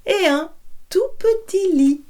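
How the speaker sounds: high and small for the tiny bed; storyteller's tiny high voice. A narrator's reading. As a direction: lift the voice up high for the little one. Three beds, big to small.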